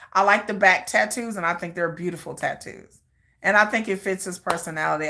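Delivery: normal talking voice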